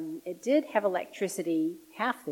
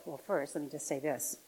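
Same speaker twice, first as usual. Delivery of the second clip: ordinary delivery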